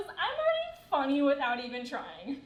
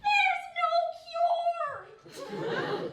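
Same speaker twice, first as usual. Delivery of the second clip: very high, drawn-out cry; big mock announcement; much slower than usual. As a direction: stretch it out slowly, way up high. Mock-celebratory punchline after a build-up.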